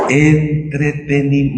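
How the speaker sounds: half-sung mocking word; mocking singsong. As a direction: sing it out like a showman, teasing. Burla del espectáculo en iglesias. (palabra medio cantada con burla)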